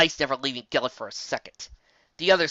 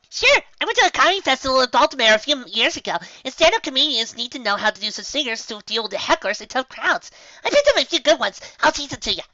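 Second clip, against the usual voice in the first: high, loud, chirpy storyteller; Pinkie voice; 12 semitones higher, 6 dB louder than usual. Acted. Pinkie boasting to friends, high and bubbly. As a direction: bounce through it cheerfully.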